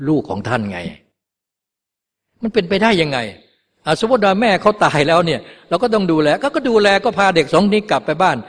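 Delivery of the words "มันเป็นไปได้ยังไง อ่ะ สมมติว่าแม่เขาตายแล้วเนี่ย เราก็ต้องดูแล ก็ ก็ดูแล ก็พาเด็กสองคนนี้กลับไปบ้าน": raised, pleading worldly worrier; worldly relative's view. Retold or imitated. Monk voicing how worldly people object, pleading and higher. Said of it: "เนี่ยคือคิด คิดแบบคนทางโลก".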